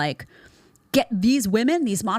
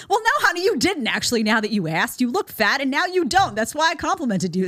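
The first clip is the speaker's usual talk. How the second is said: high, loud mock correction; acted-out blunt retort, starting very high and punchy; sassy comeback; 7 semitones higher, 3 dB louder than usual. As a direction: set honey straight with exaggerated sass.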